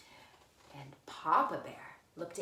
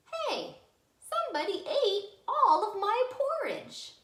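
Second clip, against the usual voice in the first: much higher, louder little cub voice; squeaky Baby Bear; 7 dB louder than usual. Acted. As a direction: squeak it like an upset tiny bear.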